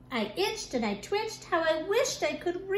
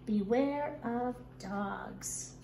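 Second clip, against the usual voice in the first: reading a sign; 4 semitones lower, 7 dB quieter, much slower than usual. A narrator's reading.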